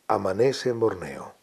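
hushed radio-theatre scene setting; radio announcer. Retold or imitated. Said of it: "un locutor que decía".

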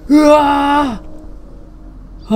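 long startled scream, high; a real cry of fright at the screen; scared yell of surprise; drawn-out scream. Real, not acted. Horror game scare.

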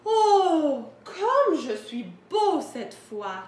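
loud, drawn-out storybook delight; admiring exclamation. A narrator's reading. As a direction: exclaim slowly in hammed-up self-admiration. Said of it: "et s'exclama". (exclamation ravie et admirative)

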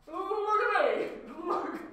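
high-pitched, loud mocking singsong; mocking imitation. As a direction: taunt them with a high mimicking voice. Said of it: "That's what you sound like".

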